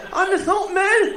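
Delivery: high, loud, slow disbelief; astonished shout. Acted. Incredulous stammerer shouting.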